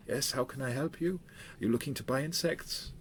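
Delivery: a hint of a Peruvian shopkeeper; insect seller. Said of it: "she has a Peruvian accent".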